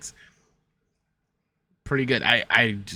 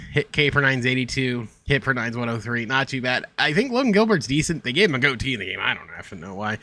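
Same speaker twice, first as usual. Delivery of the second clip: normal talking voice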